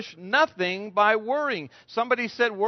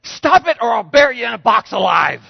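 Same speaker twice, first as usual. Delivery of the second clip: comic shout; 3 semitones higher, 9 dB louder than usual. Retold or imitated. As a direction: shout the threat for laughs. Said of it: "He said".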